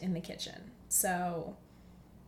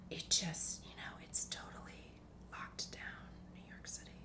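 hushed worried whisper; nervous whisper; 2 semitones lower, 9 dB quieter than usual. Retold or imitated. Family describing the lockdown, nervous whisper. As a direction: whisper it like bad news. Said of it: "we're always whispering".

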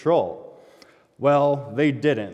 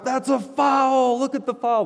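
yelling at refs; 13 semitones higher than usual. Retold or imitated. Parents in the stands yelling at the refs, high outraged yell. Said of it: "everybody stands up and yells at the refs".

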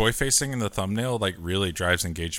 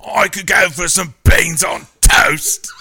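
much higher, louder, slower mock British shout; derogatory British accent; 11 semitones higher, 10 dB louder, slower than usual. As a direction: overblown posh-Brit caricature. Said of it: "has to be more derogatory".

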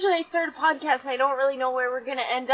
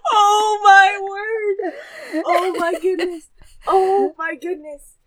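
drawn-out, higher exclamations, close to crying; shaken amazement; 6 semitones higher, slower than usual. Real, not acted. Daughter reeling after lightning by the van, shaken. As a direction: reel in disbelief.

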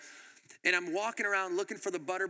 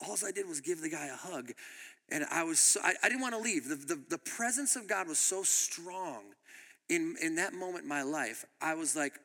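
ordinary talk

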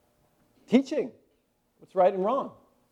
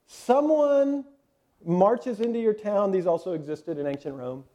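ordinary delivery

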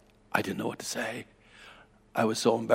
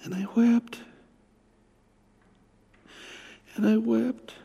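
high, strained voice near tears; much higher, choked-up delivery; tearful telling; 10 semitones higher than usual. Real, not acted. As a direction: recount it as grief rises in the throat. Recalling his weeping and repentance.

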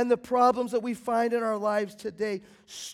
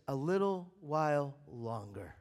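much softer, hushed answer; voice from the throne; 12 semitones lower, 10 dB quieter than usual. Acted. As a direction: let it fade to almost nothing, calm and final. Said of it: "the voice from the throne comes back and says".

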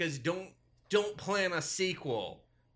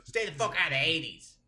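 mock rant; 8 semitones higher, 6 dB louder than usual.

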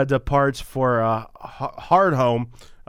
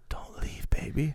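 soft breathy whisper; seductive whisper; 3 semitones higher, 9 dB quieter than usual. Retold or imitated. Voicing Gilly seductively.

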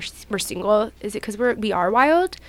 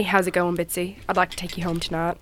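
masculine voice; 4 semitones lower, 8 dB quieter than usual. Shown as a demonstration. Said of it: "can you do your mask voice?".

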